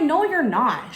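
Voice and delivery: loud, high flat denial; defiant. Retold or imitated. Snapping back at the fake officer, loud and defiant. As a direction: shut her down hard.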